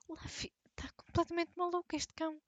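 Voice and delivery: hushed whisper at the dog. Real, not acted. Scolding the dog aside.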